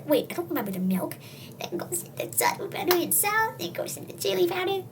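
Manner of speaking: lightly voiced, often hushed high grandma; a dotty old grandma cooking. Grandma narrating her odd stew recipe, hushed high voice.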